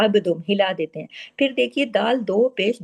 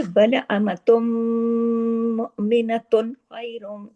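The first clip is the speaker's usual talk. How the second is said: measured, drawn-out Quranic recitation; slow recitation; 2 semitones higher, slower than usual. Shown as a demonstration. Teacher reciting the verse for students to follow. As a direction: recite it slowly, letter by letter. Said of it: "tajweed teacher demonstrating".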